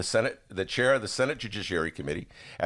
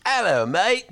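mock British accent; 10 semitones higher, 5 dB louder, slower than usual. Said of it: "he's the Brit".

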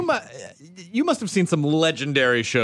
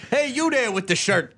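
higher insult comic heckling a crowd; Don Rickles; 8 semitones higher than usual. Retold or imitated. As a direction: jab at the crowd like an old roast comic. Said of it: "That was like Rickles".

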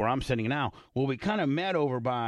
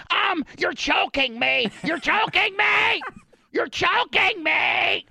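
much higher, louder and slow, rising to a shout; panicked choking plea; 6 dB louder, slower than usual. Retold or imitated. Imitating the guy being choked in the corner, panicked high shout. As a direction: squeal for help while being choked. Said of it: "he's like".

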